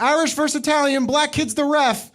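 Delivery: high, mocking schoolkid voice; high sing-song kid taunt; mock kids' voice. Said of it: "They would be like".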